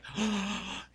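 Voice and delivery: imitated big gasp of delight; excited gasp. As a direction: gasp like a thrilled little kid. Child thrilled with squirt gun.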